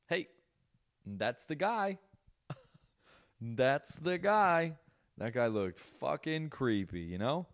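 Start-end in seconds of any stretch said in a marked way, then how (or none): 0.1-2.0 higher-pitched mock reveal reaction; mock recognition. Imitated repeated reaction to reveal. 3.4-4.7 very slow, high, stretched words; drawn-out mock recognition. Repeats the reaction, stretched out.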